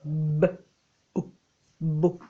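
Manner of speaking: B and O said separately, then blended into BO; sounded out. Shown as a demonstration. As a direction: sound out "b", pause, "o", then join them: "bo". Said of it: "Écoute bien".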